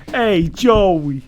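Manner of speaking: loud high Jersey wiseguy greeting; brash New Jersey tough guy; Jersey accent. Pretend. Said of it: "we do the Jersey accent".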